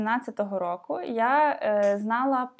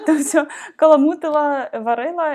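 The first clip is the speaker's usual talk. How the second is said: laughing; 4 semitones higher, 7 dB louder than usual. Real, not acted. Laughs at brewing like a witch.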